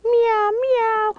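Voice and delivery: cat meow. Pretend. Toy play animal sounds.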